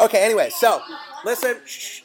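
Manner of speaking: laughing through the words, loud and high. Real, not acted. Preacher laughing off the movie argument, laughing. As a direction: chuckle while trying to move on.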